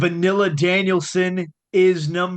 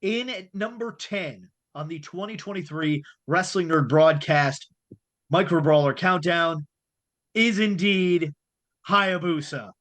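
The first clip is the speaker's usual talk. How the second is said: announcer voice; 4 semitones lower than usual. Acted. Countdown reveal, ring style.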